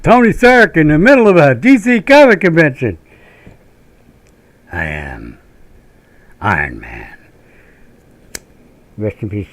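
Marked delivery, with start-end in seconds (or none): 0.0-3.0 excited. Real, not acted. Bigger hammed delivery. 4.7-7.2 very low, slow movie line; Iron Man line. Retold or imitated. Quoting the movie hero, deep and low.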